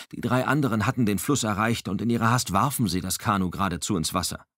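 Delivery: ordinary delivery, nothing marked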